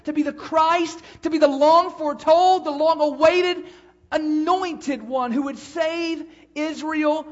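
loud, high, building preacher's cry; preaching at full force. Acted. Preacher to his congregation, building to a loud proclaiming cry. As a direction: drive it home to the whole church, swelling.

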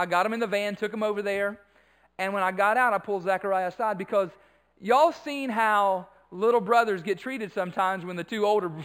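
normal talking voice

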